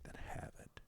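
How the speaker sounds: very soft, near-whispered quote; quiet, understated pronouncement; hushed reply. Retold or imitated. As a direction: say it almost under the breath. Jesus's reply in retelling.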